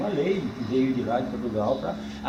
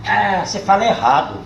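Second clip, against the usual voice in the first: put-on a higher, louder teacher scolding the kid; 6 semitones higher, 5 dB louder than usual. Teacher scolds the country boy, higher and louder. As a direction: scold like a prim schoolteacher. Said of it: "a professora moderna".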